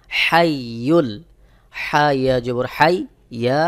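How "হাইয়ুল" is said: হাইয়ুল with হা pressed from mid-throat, slowly; correct ha. Shown as a demonstration. Teacher showing the correct throat ha. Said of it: "সহি উচ্চারণ হলো".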